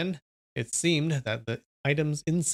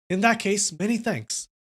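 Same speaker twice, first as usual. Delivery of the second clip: higher voice, fresh from a laugh; laughing reply; 7 semitones higher than usual. A narrator's reading. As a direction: say it brightly after laughing out. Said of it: "He laughed loudly and said".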